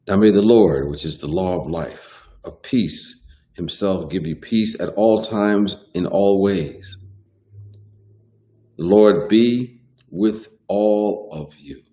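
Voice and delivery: solemn benediction with lifted pitch; scripture reading. Acted. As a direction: read it out as a blessing, drawing out the endings. Reads a Bible verse aloud.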